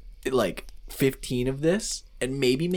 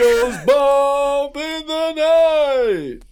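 loud, high, long-drawn arena announcement; hammed-up boxing introduction; ring announcer; 13 semitones higher, 8 dB louder, slower than usual. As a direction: bellow the title like a fight-night headliner.

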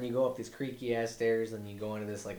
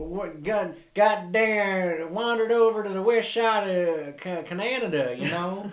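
high, loud hammed Canadian accent; rambling Canadian yokel; Canadian dude; 11 semitones higher, 10 dB louder, slower than usual. Continuing Canadian dude impression.

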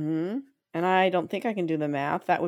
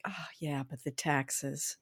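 soft, low, slow grumble; gloomy mother-in-law; 4 semitones lower, 11 dB quieter, slower than usual. Retold or imitated. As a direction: deflate the good news. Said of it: "she'd say".

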